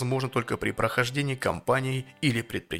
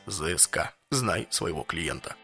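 slow and deliberate; 6 semitones lower, slower than usual. Real, not acted. Spelling out the acronym ZSK.

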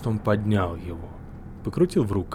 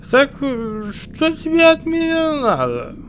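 put-on high drunken slur; 7 dB louder than usual. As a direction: slur it like a tipsy man. Drunk hero asks.

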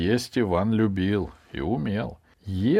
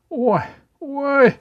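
two loud, very high cries; squealing twitchy "oy" cries; high yelps; 7 dB louder than usual. A narrator's reading. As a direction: yelp as if jolted. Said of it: "вскрикнул Иван".

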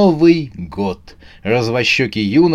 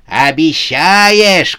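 loud, drawn-out challenge; shouting; 5 semitones higher, 5 dB louder, much slower than usual. A narrator's reading. Сюзанна challenges the promise, shouting. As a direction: shout it back sceptically. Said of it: "крикнула Сюзанна".

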